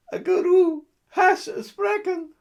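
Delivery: ordinary talk, nothing marked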